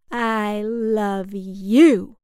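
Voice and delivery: heavy drawn-out reply. Acted. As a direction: stretch every word out, weighed down. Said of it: "she said".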